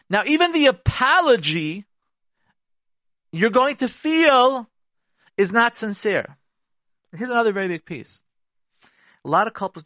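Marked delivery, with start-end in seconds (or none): 0.1-6.4 high, loud, slowed emphasis; emphatic point. Real, not acted. A counselor stressing that the apology feels insincere, drawn out and emphatic. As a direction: stretch it out to drive the point home. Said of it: "emphatic sarcastic point".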